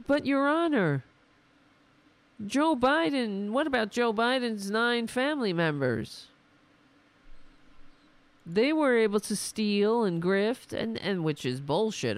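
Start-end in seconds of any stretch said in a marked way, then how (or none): 0.1-10.7 high whiny mock plea to a judge; mock pleading defendant. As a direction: plead like a guilty man. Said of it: "you go to court and you say".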